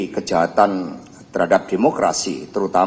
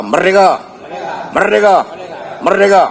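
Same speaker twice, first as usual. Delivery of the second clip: shouting; 11 semitones higher, 7 dB louder than usual. Real, not acted. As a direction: shout each merdeka high and loud. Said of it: "Marilah kita bersama-sama memekikkan salam nasional kita".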